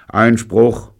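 high, loud, irritated objection; groaning indignation. A narrator's reading. Accused friend objecting to the blame, indignant. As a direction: protest with an annoyed groan. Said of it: "stöhnte Robert ungehalten".

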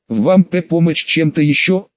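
usual speaking voice

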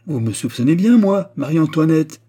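loud, high, imperious queen; haughty. A narrator's reading. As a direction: declare it haughtily, drawing out the name. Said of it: "dit la reine avec hauteur". (avec hauteur, voix forte et lente)

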